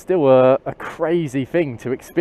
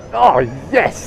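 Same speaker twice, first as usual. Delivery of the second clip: exhilarated cry of delight, higher; real thrill; 4 semitones higher than usual. Real, not acted. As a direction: cheer as the bike surges. Bike accelerates in sport mode.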